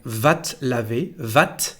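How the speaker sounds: "va te" said as vat, no E; contracted te. Shown as a demonstration. Teacher showing the first contraction, "va te" said as vat. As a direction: close "va te" on the T. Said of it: "We say something like".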